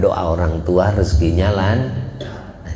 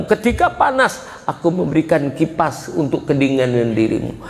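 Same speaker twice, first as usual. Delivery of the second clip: lightly voiced parent pleading to child, high and wavering; a parent telling a child of sleepless nights; preacher voicing a parent speaking to the child; voicing a loving parent; 7 semitones higher than usual.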